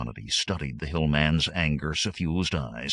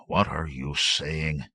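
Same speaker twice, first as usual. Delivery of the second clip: slow, deliberate question; captain asking his sergeant slowly; asked slowly; slower than usual. Acted. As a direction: draw each word out warily. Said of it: "the captain asked slowly".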